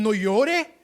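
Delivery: loud mock order not to cry; mock scolding. Preacher imitating adults who spanked children, mock scolding bark. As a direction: bark it like an exasperated parent, hammed up. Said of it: "le daban a uno y le decían".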